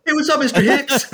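high, strained greeting trying to sound tough; a terrified guy faking cool; squeaky nervous greeting; scared squeak. Retold or imitated. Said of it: "Like, I was so scared".

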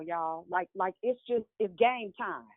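ordinary delivery

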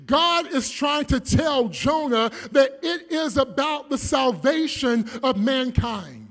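loud, rising sermon climax; full-force preaching. Acted. Preacher driving his point home to the church, full force. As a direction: thunder it out to the congregation.

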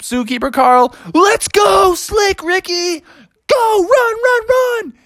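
very high, urgent cry; frantic escape. Acted. Bear fleeing the zookeeper with his friend, frantic. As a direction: cry out the getaway, pitch leaping high.

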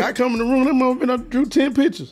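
high, exasperated mother's voice, well above normal; a mom marveling that her son drew all night, voiced high. Telling about his mom, quoting her in a high, amazed voice. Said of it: "she'd be like".

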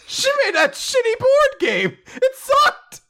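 very high, squealed complaint; half-laughing, fake-crying protest; mock wail. Laughing protest after the joke.

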